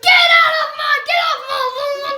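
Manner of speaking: loud, high shout to get off lawn; shouting at trespasser. Acted. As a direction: yell at the kid to leave. Homeowner chases trick-or-treater.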